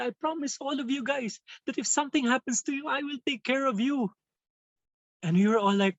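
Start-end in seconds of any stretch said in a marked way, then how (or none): 0.0-4.1 put-on higher, pleading voice of a moved teenager; tearful student voice. Student tells his teacher he was touched, higher pleading voice. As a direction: plead like a boy too touched to hold back.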